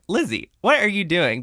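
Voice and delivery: high-pitched incredulous scolding; mock exasperation. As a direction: playfully call someone out, pitch jumping up. Retells own exasperation at the character.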